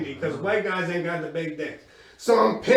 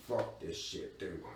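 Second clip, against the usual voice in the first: grumbled to himself; 13 semitones lower, 17 dB quieter than usual. Retold or imitated. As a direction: lower and much softer, muttered. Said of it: "I'm like".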